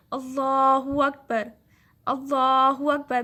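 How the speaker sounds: اللہ اکبر drawn out slowly and loudly, with the doubled l held; slow recited takbir. Shown as a demonstration. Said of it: "کیا ہے یہ؟".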